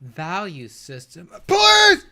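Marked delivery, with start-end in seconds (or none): 1.5-2.0 shout, much higher and louder; exasperated scream of please. Real, not acted. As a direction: explode in frustration. Exasperated outburst.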